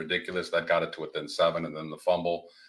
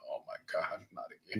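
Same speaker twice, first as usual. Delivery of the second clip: whispered dismay; 14 dB quieter, faster than usual. Retold or imitated. Retold dismay, unvoiced.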